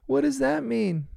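imitating a really high little voice; high-pitched voice. Bluey, tear welling up, asks Indy, imitated high-pitched voice. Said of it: "in a really high-pitched voice".

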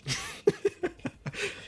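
unvoiced, high giggle; breathy high laugh. Real, not acted. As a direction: let out an airy giggle. Laughing after being teased.

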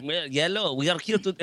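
mock German accent, hammed up; a clumsy fake German accent, played for laughs; bad German accent. Guest jokes about German ants in a bad German accent. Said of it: "that's a really bad German accent".

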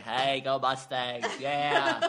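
slow, pinched nasal cheer; nasally voice. Retold or imitated. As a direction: cheer it through the nose. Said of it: "I did, like, a nasally voice".

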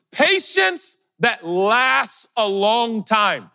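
high and slow, stretching each word; very slow, high preaching lift; soaring preach. Acted. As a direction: lift it up like a sermon peak. Preaching the virtues list.

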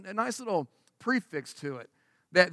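ordinary talk, nothing marked

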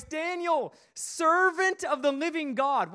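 high, strained cry of a distressed king; anguished call. Acted. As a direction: call out in anguish toward the den. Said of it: "he called to Daniel in an anguished voice".

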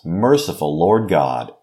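ordinary talk

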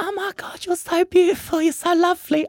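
high, squealing tipsy woman's gush; drunk gushing wife. Retold or imitated. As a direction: gush like a drunk fan at a drag show.

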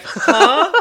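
high, loud baffled huh; mock confused huh. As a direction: squeak out the confusion. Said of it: "Kellis is like".

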